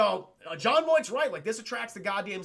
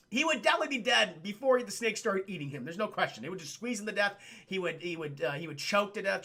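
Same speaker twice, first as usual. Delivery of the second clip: normal talking voice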